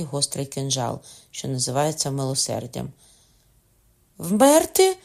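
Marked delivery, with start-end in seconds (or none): 4.2-5.0 high, loud cry of disbelief; shocked cry. A narrator's reading. Old father hearing his son must die, shocked cry. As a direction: cry out the question in horror. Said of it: "скрикнув старий".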